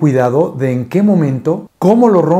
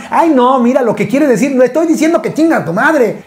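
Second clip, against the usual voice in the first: flustered wife; 8 semitones higher than usual. Retold or imitated. Wife explains to friends.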